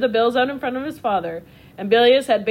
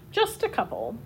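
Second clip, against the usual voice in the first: quiet coy; 10 semitones higher, 6 dB quieter than usual. Shown as a demonstration. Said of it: "Oscar repeated".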